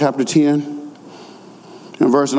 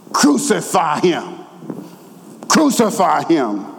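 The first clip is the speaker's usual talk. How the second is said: ordinary talk, nothing marked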